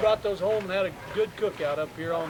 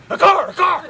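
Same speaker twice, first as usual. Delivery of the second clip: high, loud alarmed cry; retold panic, shouting high; panicked shout; 12 semitones higher, 12 dB louder than usual. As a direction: yell it like the law just showed up. Panicked friend spots a car.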